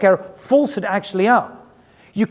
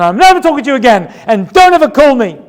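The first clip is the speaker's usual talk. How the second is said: higher, louder, fast quarrel voice; mock angry quarrel; 6 semitones higher, 7 dB louder, faster than usual. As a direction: spit it out like a fed-up fighter. Two people fighting, retold.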